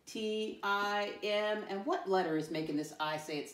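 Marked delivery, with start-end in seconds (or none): 0.1-1.6 very slow, drawn-out letters; spelling aloud. Shown as a demonstration. Teacher spells a word with pupils, very slow spelling aloud. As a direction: stretch out each letter for pupils.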